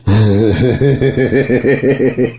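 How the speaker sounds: high chuckling burst; laughter. Real, not acted. Laughing at own dark joke.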